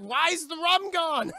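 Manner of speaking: high, loud, slow wail; anguished yell. Retold or imitated. Pirate wails at Elizabeth over the lost rum, anguished. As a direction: wail it like the rum was everything. Famous rum line yelled.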